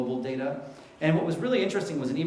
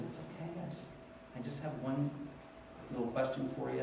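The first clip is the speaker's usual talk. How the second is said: very hushed, halting, nearly whispered; timid quiet request; 2 semitones lower, 12 dB quieter than usual. Retold or imitated. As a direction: imitate a meek patient barely daring to interrupt. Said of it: "she just quietly whispers".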